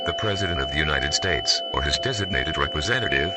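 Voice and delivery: loud, formal broadcast read over music; emergency alert announcer. Acted. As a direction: call it out like an emergency alert system on air.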